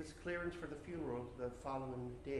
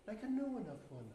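ordinary delivery, nothing marked

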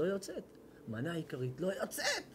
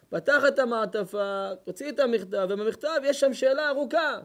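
much louder, higher, full-force sermon shout; fiery preaching; 8 semitones higher, 9 dB louder than usual. Acted. Preacher telling the congregation of the rabbi's letter, fiery shout.

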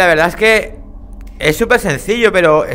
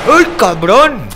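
startled; 7 semitones higher, 7 dB louder, much slower than usual. Real, not acted. Startled by something in game.

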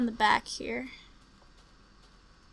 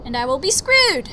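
And I will be screwed, blurted loud; real panic; 2 semitones higher, 8 dB louder than usual. Real, not acted. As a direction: yelp of dread. Panicked exclamation.

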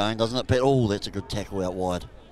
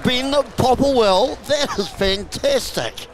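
high, loud, drawn-out celebration; jubilant try call; 12 semitones higher, 8 dB louder, much slower than usual. Real, not acted. Commentator celebrates Ben Popplewell's try with a slow, jubilant shout. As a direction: belt out the scorer's name, stretching each word.

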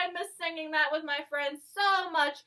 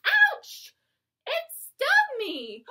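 mock pained yelp; 10 semitones higher, slower than usual. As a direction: yelp like you've been stung. Acting a bee sting.

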